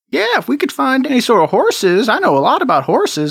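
high, eager criminal voice; Jeremy NPC voice. Acted. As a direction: sound keen about horses.

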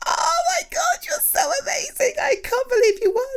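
thrilled near-tearful shout; excited shout. Acted. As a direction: burst out with amazement. Sees the trophy.

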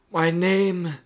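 high, aged telepathic figure; mysterious old voice. Acted. Mysterious voice revealing itself to Marty, old person voice. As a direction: reveal it as an old, otherworldly being.

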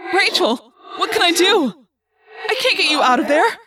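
loud, high, anguished helplessness; desperate. A narrator's reading. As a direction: cry out, powerless to save a friend. Helpless friend cannot rescue her.

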